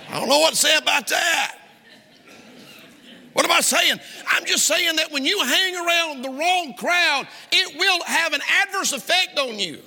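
high, forceful preaching pitch. Real, not acted. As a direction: lift the voice high and push it at the crowd.

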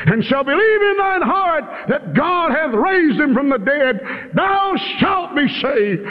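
high, ringing scripture declamation; impassioned preaching. Real, not acted. Preacher declaring the promise of salvation, impassioned. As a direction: cry the verse out high, stretching the final words.

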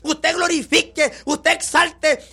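predicador a voz en cuello, con fervor; predicación enérgica. Acted. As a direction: exhorta a la congregación con fuerza. Preaching to congregation. (predicando con pasión a la congregación)

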